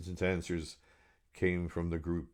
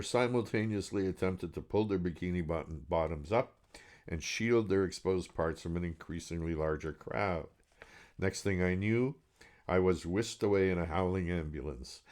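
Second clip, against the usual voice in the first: ordinary delivery, nothing marked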